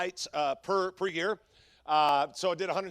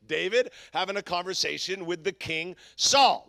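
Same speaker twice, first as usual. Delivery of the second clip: ordinary talk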